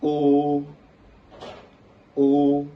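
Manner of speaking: O held high and level; first tone. Shown as a demonstration. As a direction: sing out "O" loud on one steady high note. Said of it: "On applique la même chose".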